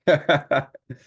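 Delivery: a high, loud burst of disbelieving laughter; stunned cackle; amazed laugh. Real, not acted. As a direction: laugh out in shock at the cards. Reacts to an uncanny card roll.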